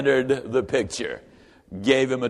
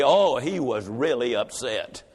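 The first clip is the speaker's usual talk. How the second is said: drawn-out "Oh, he was really upset"; low and slow, let it land; deep, unhurried refrain; 4 semitones lower, slower than usual. A narrator's reading.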